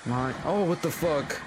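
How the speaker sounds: shocked high outburst; startled. Real, not acted. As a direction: caught off guard, blurt it out. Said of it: "startled by sudden event".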